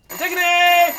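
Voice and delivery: loud, high call across the house. Acted. Brother calls through the house for his sister, loud call. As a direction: shout her name to find her.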